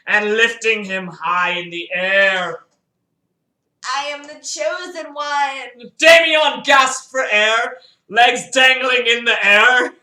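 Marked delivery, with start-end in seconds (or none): 4.0-5.9 shout. Acted. Villain lifts son by throat. 6.0-9.9 booming, slowed storyteller voice; thundering narrator; loud dramatic narration. A narrator's reading.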